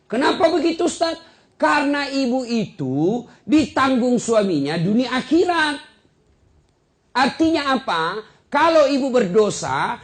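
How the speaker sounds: high, loud, forceful sermon voice; preacher warning wives, loud and high; preaching at full force. Acted. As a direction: hammer each line home to the congregation.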